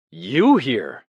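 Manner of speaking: loud, high surprised exclamation; startled. Acted. Winston startled at seeing Parsons in the cell, blurting out. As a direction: blurt it out in shock.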